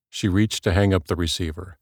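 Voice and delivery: ordinary delivery, nothing marked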